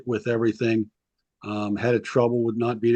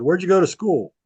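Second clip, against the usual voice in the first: higher, pointed question; suspicious coach question; 7 semitones higher, 4 dB louder than usual. Retold or imitated. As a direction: probe him with the question. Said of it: "he said".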